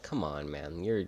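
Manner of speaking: soft, slow half-hearted pushback; meek quiet protest. Retold or imitated. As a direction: mumble it back timidly. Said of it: "Which I'm like".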